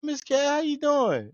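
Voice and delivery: high, slow sugary greeting; butter her up, high and slow; overly polite greeting. Retold or imitated. Son greeting his stepmother on the phone, high and sweet. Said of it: "talk to her".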